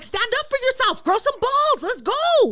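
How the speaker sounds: high-pitched, hollered egging-on; rousing pep talk. Retold or imitated. Woman urging a man to stand up for himself, rousing. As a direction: re-enact hyping someone up to fight back.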